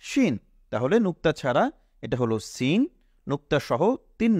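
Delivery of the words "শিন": শিন said high, the sh sound clear; letter name shin, high. Shown as a demonstration. Teacher announcing the letter শিন to students, high. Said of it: "এটি হলো".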